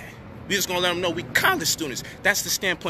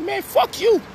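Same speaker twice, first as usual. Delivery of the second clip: sudden high, loud mock outburst; retold outburst; 5 dB louder, slower than usual. To the woman who crossed him, a high, loud retort. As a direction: snap it out, way up high. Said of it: "I'm like".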